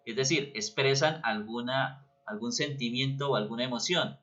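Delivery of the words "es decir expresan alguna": usual speaking voice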